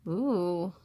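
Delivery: soft low ooh of surprise; a gasped, hushed ooh; quiet startled ooh. Real, not acted. Reacting to scary page.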